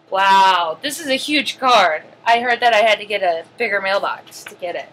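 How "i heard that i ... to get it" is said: normal talking voice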